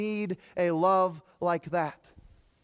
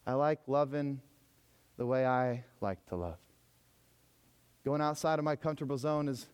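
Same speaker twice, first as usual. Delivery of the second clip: lower, softer smug mock voice; mock complacency; 5 semitones lower, 5 dB quieter than usual. Comfortable Americans shrugging off risky love, mock smug. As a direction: parody the comfortable believer. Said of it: "these American people going".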